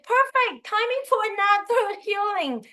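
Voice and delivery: mimicking the coach, high and laughing; a bright laugh through the line; teasing coach, laughing mid-line. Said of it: "she said".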